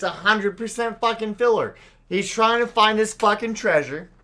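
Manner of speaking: loud, high-pitched exasperation; exasperated rant. Real, not acted. Ranting about filler in One Piece.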